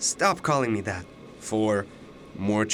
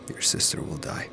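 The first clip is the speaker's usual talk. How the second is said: a whispered threat of doom; ominous whisper; 3 dB quieter than usual. Acted. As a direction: whisper it, barely breathed. Ominous prophecy of sister's death.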